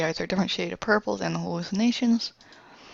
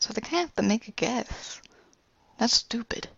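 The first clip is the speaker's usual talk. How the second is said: whisper; 4 semitones higher than usual. Retold or imitated. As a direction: drop to a whisper. Characters discuss gender.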